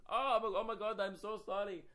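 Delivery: imitation of an apologetic Indian driver; Indian accent. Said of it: "I don't wanna do an Indian voice".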